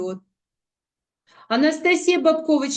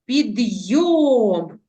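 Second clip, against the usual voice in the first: Підйом with a long, strong йом; drawn-out stressed ending of Підйом; stress on second syllable; 4 dB louder, much slower than usual. Shown as a demonstration. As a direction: hold and lean on йом in Підйом. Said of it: "вимовляємо з більшою силою голосу".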